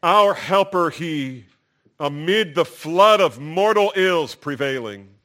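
ringing hymn recitation from the pulpit; proclaimed hymn lyric. Shown as a demonstration. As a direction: lift the hymn line up to the congregation. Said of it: "let me just read some of the lyrics to you".

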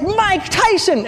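high, slow exclamation; excited shout. Retold or imitated. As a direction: punch his shoulder and shout. Punches friend, shouts name.

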